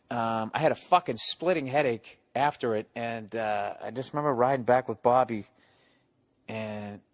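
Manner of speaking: normal talking voice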